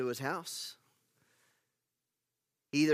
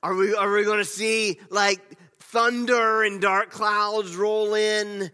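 higher, louder and slow, thunder and dark clouds drawn out big; dramatic excitement; 7 semitones higher, 9 dB louder, slower than usual. Acted. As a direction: ham it up like a movie trailer. Mock-dramatic imagined storm.

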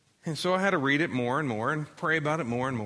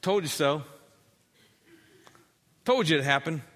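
smug swagger. Retold or imitated. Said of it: "I can see the swagger".

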